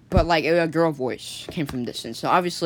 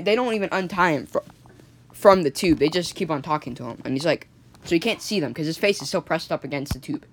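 ordinary delivery, nothing marked

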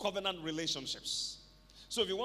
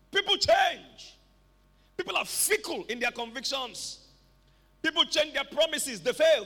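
raised, higher-pitched preaching voice; impassioned preaching; 5 semitones higher, 7 dB louder than usual. Real, not acted. Preacher on learning to know God, impassioned preaching. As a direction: press each point on the congregation, loud and high.

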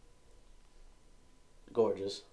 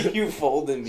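laughing hard; 6 semitones higher, 10 dB louder than usual. Real, not acted. Cracking up at a joke.

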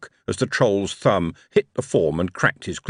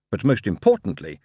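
ordinary delivery

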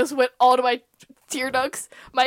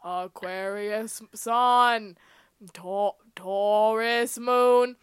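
slow, drawn-out presidential speech, last line much louder; JFK impression; 8 dB louder, much slower than usual. Asked by cohost for a JFK voice, slow and booming at the end. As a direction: orate it like a sixties president. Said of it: "say it in the J F K voice".

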